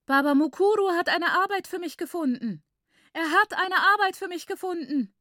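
high, elated, singing out the news; jubilant announcement. A narrator's reading. Lucia shares news of her job, high jubilant cry. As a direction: proclaim it in overjoyed, high-pitched exclamation.

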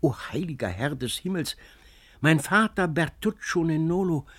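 a touch of awed outcry; overwhelmed cry. A narrator's reading. Antonio kneels before his foster father, overwhelmed. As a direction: cry out in amazement. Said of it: "ganz außer sich selbst gebracht".

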